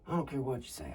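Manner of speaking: stubborn hushed whisper. Acted. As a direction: defy him quietly under breath. Whispered argument in blind.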